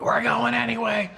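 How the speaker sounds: high, bursting announcement; spring the surprise on her in a high voice; gleeful reveal. Retold or imitated. Telling his wife the trip is booked, a high excited reveal.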